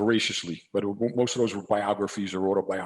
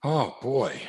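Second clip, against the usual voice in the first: ordinary delivery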